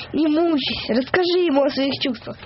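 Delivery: ordinary delivery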